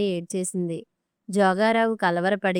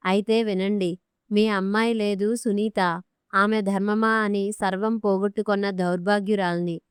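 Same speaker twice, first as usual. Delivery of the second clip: plain reading voice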